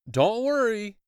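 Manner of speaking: loud, high call. Acted. Rescuer calling again to the boy. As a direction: shout reassurance again. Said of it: "he repeated".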